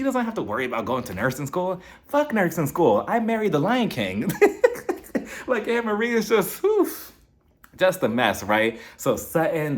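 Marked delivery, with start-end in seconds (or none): 4.3-5.4 a high, airy laugh; real amused laugh; high breathy laugh. Real, not acted. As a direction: let the laugh squeak out. Laughs at her own joke.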